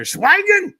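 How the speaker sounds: a sudden, very high, loud shout of Schweigen; shouted command. Retold or imitated. Host recalling the shout after the credits, high loud yell. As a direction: bark it out like a command.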